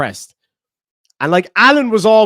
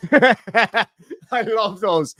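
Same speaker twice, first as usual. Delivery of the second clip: laughing; 3 semitones higher, much slower than usual. Real, not acted. Laughing at the jail meme.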